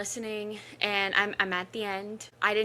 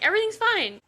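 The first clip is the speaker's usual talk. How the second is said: mock reassurance; 11 semitones higher, 3 dB louder, faster than usual. Retelling her relief.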